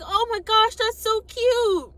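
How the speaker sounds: high, drawn-out cooing; imitated gushing girl. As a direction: gush in a squeaky high voice. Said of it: "She was like".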